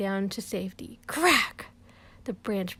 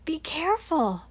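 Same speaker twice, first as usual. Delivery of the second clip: calling a warning; 7 semitones higher, 3 dB louder than usual. Acted. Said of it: "I say".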